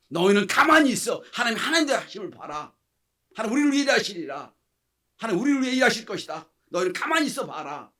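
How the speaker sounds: very loud, high, ringing proclamation; forceful commands, each phrase punched out; Moses commanding. Acted. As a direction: call out to a panicking crowd with total conviction. Preacher voices Moses commanding the people.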